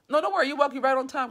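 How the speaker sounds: plain reading voice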